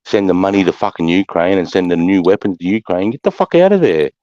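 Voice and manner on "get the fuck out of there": much higher, heated yelling at the Prime Minister; angry tirade. Real, not acted. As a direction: berate him like a coward.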